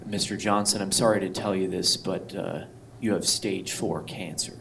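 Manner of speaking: soft, low mock doctor's bad news; a hint of a grave doctor; somber doctor. Doctor giving bad news, mock somber and soft. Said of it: "they sound like".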